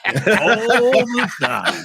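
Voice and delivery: slow, drawn-out announcer voice. Shown as a demonstration. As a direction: stretch it like a radio host. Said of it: "gonna start doing it again".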